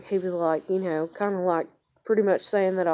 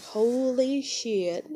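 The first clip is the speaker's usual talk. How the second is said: slow, drawn-out, higher retold amazement; stunned reaction; 5 semitones higher, much slower than usual. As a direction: stretch it out in mock awe. Retelling her shocked reaction.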